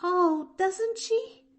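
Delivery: put-on high, eager little girl voice, a catch in the breath; a small orphan girl bravely glad; young girl Pollyanna. Orphan girl to Nancy, high and earnest.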